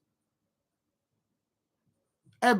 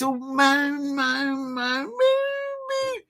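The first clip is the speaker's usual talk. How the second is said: rising into a high, slow, whiny wail; mock him as a crybaby; mock whine; 9 semitones higher, much slower than usual. Mocking the manager as whiny.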